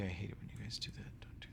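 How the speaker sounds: a hushed, teasing telling-off; mock scolding whisper. Real, not acted. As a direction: whisper it, slowing right down at the end. GM playfully scolds players' tokens.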